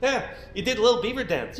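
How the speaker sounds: ordinary delivery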